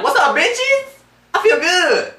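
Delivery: high, loud, swaggering boast; hammed-up high cocky voice; cocky Sony voice. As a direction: strut like a smug company mascot.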